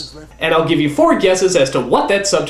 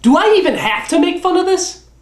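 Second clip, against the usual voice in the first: exasperated; 14 semitones higher than usual. Real, not acted. Mocking a silly movie scene.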